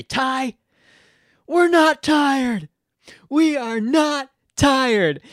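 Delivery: high, drawn-out mock yell; retold yell. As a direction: imitate calling out to a teammate, stretched and high. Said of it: "I would just yell at Ty".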